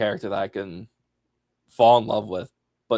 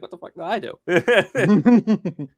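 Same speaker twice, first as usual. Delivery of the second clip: puzzled; 9 semitones higher, 6 dB louder, much slower than usual. Retold or imitated. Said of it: "he's like".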